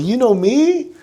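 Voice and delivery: high, shy disbelief; bashful. Retold or imitated. Fan surprised a celebrity knows him, asking in bashful high disbelief. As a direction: ask it sheepishly, like a flattered kid. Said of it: "bashful, like".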